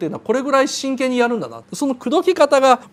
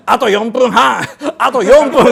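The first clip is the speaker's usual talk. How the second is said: stingy rink man; 5 dB louder, slower than usual. Retold or imitated. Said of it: "おっさんが世知辛くて".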